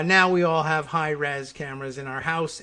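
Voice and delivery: ordinary talk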